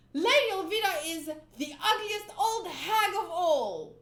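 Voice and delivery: loud, put-on boyish voice declaring it; timid boy finally yelling back at the captain; defiant Koby shout. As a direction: fling the insult out bravely. Koby finally defies Alvida.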